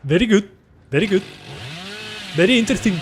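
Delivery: high-pitched mock Indian accent; short phrases in a put-on Indian accent. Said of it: "read it in an Indian accent".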